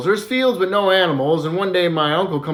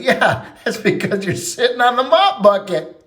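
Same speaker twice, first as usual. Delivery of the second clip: laughing through the words; 5 semitones higher than usual. Acted. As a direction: chuckle out the punchline. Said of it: "and starts laughing".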